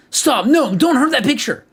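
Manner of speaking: rising frantic shout; desperate cry. Acted. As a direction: cry out to save the picture.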